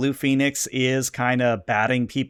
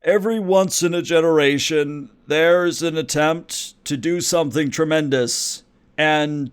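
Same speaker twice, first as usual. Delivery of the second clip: grand speech; 3 semitones higher than usual. Acted. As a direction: unveil the invention like a statesman. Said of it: "clears his throat and says".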